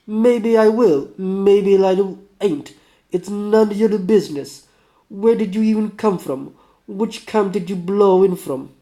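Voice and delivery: put-on defiant Russian accent, high and loud. Sick man challenging a drifter, defiant Russian accent. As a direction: brush off the question in a Russian accent. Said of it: "do my best, uh, Russian".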